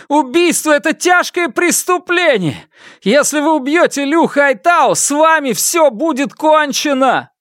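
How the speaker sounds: high, urgent warning to a crowd; shouted warning. Acted. Фан Му warning villagers about murder, shouted warning. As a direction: warn them at the top of your register.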